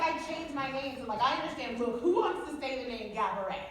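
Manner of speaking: ordinary talk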